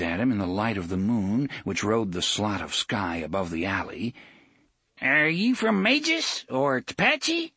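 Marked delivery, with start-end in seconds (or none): 5.0-7.5 put-on high, slow, wary old man; suspicious old man. A narrator's reading. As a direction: squint at him with your voice. Old man questions DePape.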